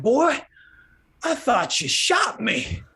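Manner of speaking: put-on high, loud gasp of boy, then slow; the farmer clutching his chest after the jolt; shaken old farmer. After the shock, farmer tells the salesman high and shaken.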